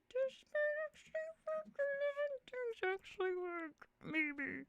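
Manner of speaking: high, thrilled squeak of disbelief; excited squeal. Real, not acted. Streamer thrilled her audio setup works.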